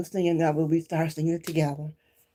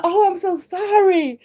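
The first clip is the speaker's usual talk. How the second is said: high, loud, slow gushing apology; mock apologetic stranger; 7 dB louder, slower than usual. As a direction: stretch out the lady's sorry.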